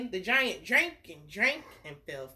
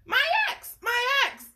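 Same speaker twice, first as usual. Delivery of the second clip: high, loud urgent call; 7 dB louder than usual. Acted. Jack calls to his mother for the axe, urgent shouting. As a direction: yell down to mother for help. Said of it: "Jack called to his mother".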